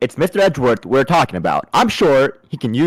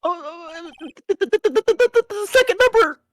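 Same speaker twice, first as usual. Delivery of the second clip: high stammer; 3 dB quieter than usual. Acted. Stammering character reads list.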